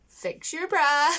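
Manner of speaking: mock calling out. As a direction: call it out slowly, imitating him. Acts out his called-out line.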